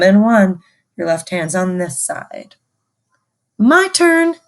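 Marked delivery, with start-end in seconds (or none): 3.6-4.4 high, bright call: my turn; teacher calling the class in. Shown as a demonstration. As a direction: sing out my turn higher. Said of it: "call and response".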